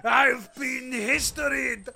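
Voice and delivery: shouting, sputtering villain; sputtering foiled villain. Acted. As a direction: spit out a foiled villain's curse. Defeated captive curses his conqueror.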